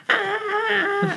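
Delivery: high-pitched, soft mock squeal; imitated scream. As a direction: squeal like screaming girls, but keep it small. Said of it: "screaming, like".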